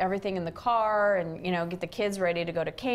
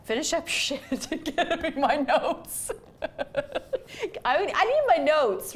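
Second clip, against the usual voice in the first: mock demanding; 8 semitones higher than usual. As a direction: play the bossy inner voice half in jest. Her inner demanding voice, retold.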